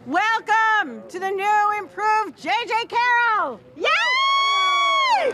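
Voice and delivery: slow, high, shouted welcome building to a cheer; loud drawn-out hype, soaring at the end; rousing welcome shout. Real, not acted. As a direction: announce it to a cheering crowd, stretching the words. Host cheers opening of event.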